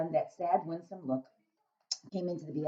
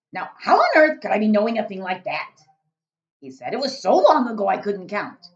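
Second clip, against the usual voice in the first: loud, booming friendly giant; a big-hearted giant rumbling away; 4 semitones higher, 13 dB louder than usual. Acted. BFG speaking.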